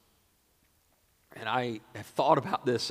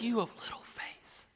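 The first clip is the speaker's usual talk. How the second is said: whispered, higher-pitched reproach; acted soft rebuke; hushed rebuke; 10 semitones higher, 9 dB quieter than usual. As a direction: drop to a whisper after the shouting. Acts Jesus waking to answer.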